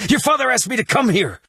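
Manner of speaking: high-pitched, pleading shout after her; urgent calling out. Acted. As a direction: call after someone running away. Calling after a fleeing girl.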